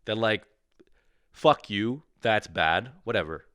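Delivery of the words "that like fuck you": usual speaking voice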